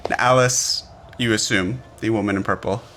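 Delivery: ordinary talk, nothing marked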